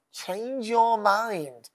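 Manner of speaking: very slow, every word weighed; Change your mind drawn out word by word; slow emphasis. Shown as a demonstration. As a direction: spell out the meaning slowly. Defines repent, drawn out.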